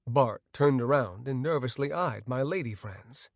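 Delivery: ordinary delivery, nothing marked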